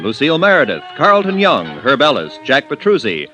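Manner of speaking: usual speaking voice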